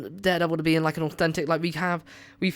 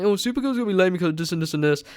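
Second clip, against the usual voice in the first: higher, fast sneering whine; mocking online commenter; 5 semitones higher, 3 dB louder, faster than usual. Vlogger mocks sneering comments about Supergirl, fast and whiny. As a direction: parody a lazy hater fast and high. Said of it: "crappy comments going like".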